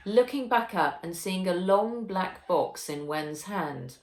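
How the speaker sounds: ordinary delivery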